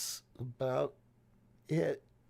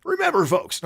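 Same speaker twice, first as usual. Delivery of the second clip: higher, louder radio-host voice; Rush Limbaugh impression; 11 dB louder than usual. Host signs off mimicking a radio talker. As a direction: boom it out like a talk-radio host. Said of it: "as Rush Limbaugh would say".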